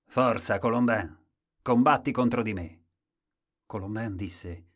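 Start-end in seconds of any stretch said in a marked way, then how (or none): 0.0-2.8 loud, forceful challenge; loud storybook challenger; challenger's call. A narrator's reading. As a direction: call him out to a fight, loudly. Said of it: "Quando qualcuno disse".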